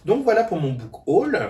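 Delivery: "voilà" lifted high and loud; cheerful sign-off. Real, not acted. Booktuber closing her book haul for viewers, high and loud.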